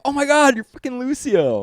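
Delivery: high, loud, starstruck exclamation; excited squeal. Retold or imitated. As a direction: squeal it like meeting your hero. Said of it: "and I'm like".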